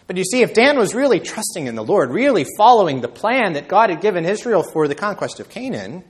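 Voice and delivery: high, loud, emphatic sermon voice; impassioned preaching. Real, not acted. Preacher arguing what true trust would mean, impassioned. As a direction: press the point home from the pulpit. Preacher builds at full force.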